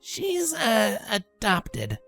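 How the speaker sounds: hesitant, slow and high; awkward, halting confession; sheepish. A narrator's reading. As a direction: softly stumble into the admission. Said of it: "Rainbow stated sheepishly".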